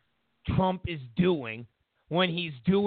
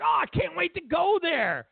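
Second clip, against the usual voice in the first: higher, eager gush; mock eager Trump; 10 semitones higher than usual. Trump faking eagerness on the phone, higher gushing voice. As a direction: gush like a bored politician faking excitement. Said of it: "when Trump was like".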